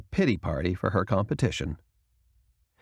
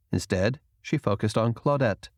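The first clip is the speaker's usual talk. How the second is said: plain reading voice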